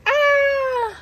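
drawn-out, high "aah"; high cry of alarm. Acted. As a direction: cry out high as things topple. Acting out a story mishap.

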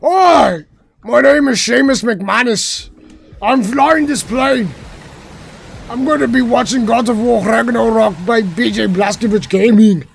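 high, loud, hammed-up Scottish brogue; Scottish accent. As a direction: proclaim like a proud Scottish pilot, high and loud. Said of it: "Let me put on my Scottish accent".

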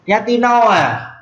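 "Ra ti no à?" cao hẳn và chậm; hỏi bằng tiếng Mường, giọng lên cao, kéo dài; Mường question. Shown as a demonstration. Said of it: "tiếng Mường". (câu hỏi Mường cao, chậm rãi)

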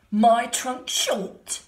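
very slow, loud booming; offended elephant. A narrator's reading. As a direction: boom it in disbelief. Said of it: "said the elephant".

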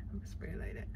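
hushed whisper while spraying; a secretive whisper mid-prank. Real, not acted. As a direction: breathe the words out, no voice. Spraying the prank bottle carefully.

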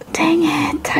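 whispered "Dang it" under breath. Real, not acted. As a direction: hush it like a private curse. Misjudged a contestant.

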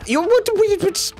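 very high, stammered squawk of disbelief; high-pitched incredulous outburst; flabbergasted. Real, not acted. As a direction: splutter in shock. Sudden shock in game.

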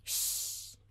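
long unvoiced shh; shushing sound. Shown as a demonstration. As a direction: hush them with a soft shh. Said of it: "com esse som".

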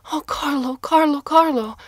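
high, loud, overwrought cries; wife's shriek. A narrator's reading. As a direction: cry his name over and over from above. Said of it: "his wife shrieked from above".

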